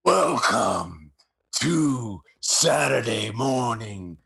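slow, drawn-out announcer voice with no voice left; raspy whisper announcer; Vince McMahon impression. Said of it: "so my, my Vince is gonna be awesome".

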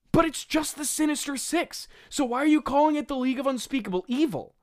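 high, exasperated comic protest; worked-up, high-pitched bafflement; incredulous. Real, not acted. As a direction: argue with the script in shrieky disbelief. Incredulous rant at the script.

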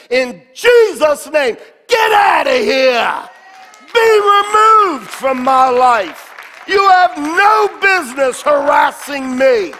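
loud, forceful command, slowed; commanding shout. Retold or imitated. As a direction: order the demons out. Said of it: "I say to that mountain".

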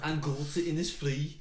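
exaggerated Scouse poem recital; Scouse accent. Trying a Scouser version of Innisfree, mock Scouse accent. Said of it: "Or Scouser one".

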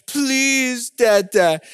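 put-on high, drawn-out baby-boy begging; sweet toddler plea. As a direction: imitate a tiny child pleading sweetly. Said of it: "looks at me so sweetly".

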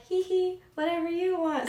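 high giggly sweet voice; giggly ditzy voice. Retold or imitated. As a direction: play the airhead, giggling. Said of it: "I'm very, like".

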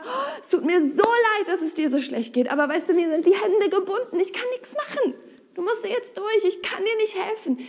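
high, pitying voice with a laugh; mock helpless God. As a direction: play a God whose hands are tied. Powerless God voiced, helpless pity.

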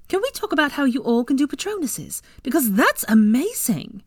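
high, eager enthusing; excited. Acted. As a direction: marvel at it. Tracy enthusing.

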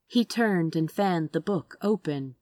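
ordinary delivery, nothing marked

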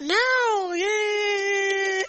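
high, drawn-out singsong yay; long squealed hooray; celebratory cheer. Real, not acted. Celebrates being back at job.

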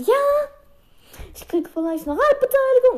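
high, squeaky hopeful voice; mock excitement. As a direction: pipe it up high as a gleeful thought. Voices own hopeful thought.

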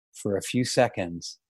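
ordinary delivery, nothing marked

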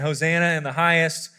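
ordinary talk

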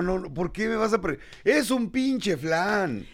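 slow, drawn-out disbelief at a flan; exasperated protest. Acted. Host protesting to his co-host, slow exasperated outrage. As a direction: stretch it out in outrage.

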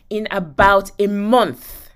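ordinary talk

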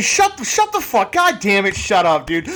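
high-pitched, fed-up outburst; mock exasperation. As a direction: play fed up with someone's gushing post. Acted annoyed reaction to couples' posts.

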